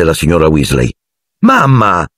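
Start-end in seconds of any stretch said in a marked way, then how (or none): 1.4-2.1 high-pitched exclamation of mock discovery; feigned surprise. A narrator's reading. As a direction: call out as if only just noticing her. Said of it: "come se l'avesse vista solo in quell'istante".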